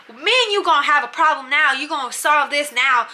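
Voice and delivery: hammed-up picking of a fight; mock confrontational. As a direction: act out an over-the-top challenge. Bunkie threatening, "doing too much.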